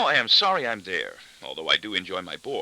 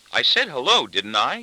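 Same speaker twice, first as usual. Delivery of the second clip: high, louder defensive retort; sharp retort; 5 semitones higher, 6 dB louder than usual. A narrator's reading. Daughter to her questioning father, retorting. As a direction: fire it back as a retort. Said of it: "she retorts".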